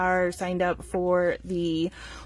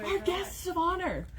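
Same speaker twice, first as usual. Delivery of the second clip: laughing; 8 semitones higher, 7 dB quieter than usual. Real, not acted. Laughing greeting to arriving guests.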